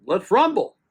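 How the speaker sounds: husband retelling his wife's playful plan, in a cheeky tone; playful invitation. Retold or imitated.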